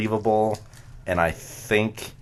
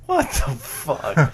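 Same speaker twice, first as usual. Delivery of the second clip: disbelief; 8 semitones higher, 4 dB louder, much slower than usual. Real, not acted. Stuck with odd gimmick question.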